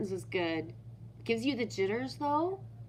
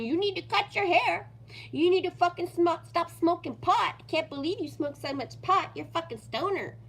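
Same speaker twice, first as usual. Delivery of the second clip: high, nagging scold, half-hearted; mock nagging girlfriend; 7 semitones higher than usual. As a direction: nag him about his hair. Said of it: "all of a sudden they're with him".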